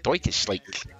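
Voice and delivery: put-on low German-accented catchphrase; mock German accent. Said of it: "we say it in a German accent".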